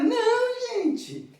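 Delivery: very high, protesting "no"; mock-indignant correction to the class; exasperated denial. Answers the mock student question.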